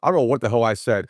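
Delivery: usual speaking voice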